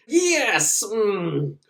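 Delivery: mock ecstatic. Possessed woman enjoys it.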